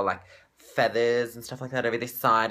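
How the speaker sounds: normal talking voice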